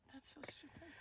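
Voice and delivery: breathy whisper under the story; hushed scoff; whispered aside. Real, not acted. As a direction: whisper it so only the mic hears. Muttered aside during story.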